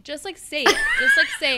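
high laughing mock yell; laughing shout. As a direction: push through laughter. Imitating friend pushing, laughing.

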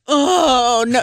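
high, loud mock cry of dismay; heartbroken wail. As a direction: wail it like a let-down little kid. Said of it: "He's heartbroken".

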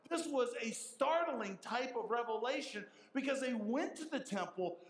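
ordinary talk, nothing marked

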